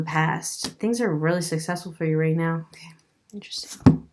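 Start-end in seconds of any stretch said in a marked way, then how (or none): 2.7-4.1 whisper. Real, not acted. Reacting to the cards.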